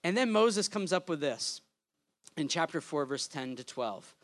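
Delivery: ordinary talk, nothing marked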